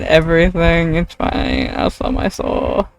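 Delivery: ordinary delivery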